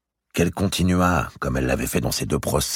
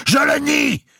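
a very high, loud shouted denial; howl; 7 dB louder, slower than usual. A narrator's reading. Mao howling her denial at Robert. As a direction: scream the denial. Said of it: "hurla Mao".

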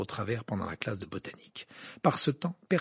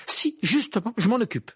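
high, loud, heated comeback; fervent; 10 semitones higher, 8 dB louder than usual. A narrator's reading. Harry contradicting the professor, with heat. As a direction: insist hotly, voice jumping up. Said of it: "dit Harry avec ardeur".

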